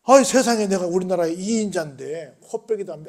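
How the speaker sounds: ordinary talk, nothing marked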